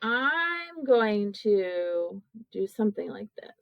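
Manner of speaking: ordinary talk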